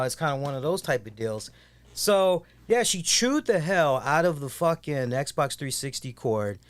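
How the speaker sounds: normal talking voice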